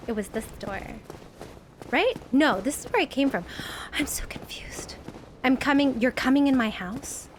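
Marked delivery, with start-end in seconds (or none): 3.7-5.0 soft, voiceless aside; hushed, bewildered whisper; confused whisper. Real, not acted. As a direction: whisper it to yourself, lost. Muttering while lost in game.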